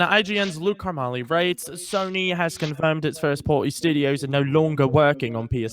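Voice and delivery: mock British news delivery; British accent. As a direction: read the story in a put-on British accent. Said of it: "I read it in a British accent".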